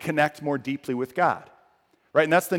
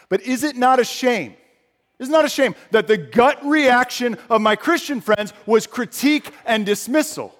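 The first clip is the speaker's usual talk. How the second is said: loud, high preaching lament; impassioned sermon; preaching climax; 7 semitones higher, 5 dB louder than usual. Real, not acted. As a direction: press the shame on the church.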